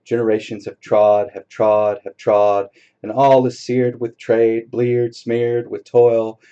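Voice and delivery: forceful dramatic poetry reading; poem recital. Acted. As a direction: proclaim it like a sermon. Reads Hopkins aloud.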